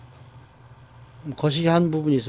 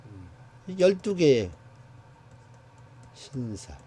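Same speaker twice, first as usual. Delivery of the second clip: normal talking voice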